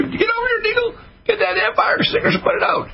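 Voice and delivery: high-pitched mock cry for help; imitated panicked plea. Doubter begging Deagle for the fire extinguisher, mock panic.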